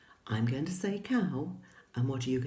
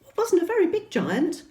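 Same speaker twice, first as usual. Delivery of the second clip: high, fast playful teasing; mock disappointment; 6 dB louder than usual. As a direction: tease the kids, much higher. Teasing children.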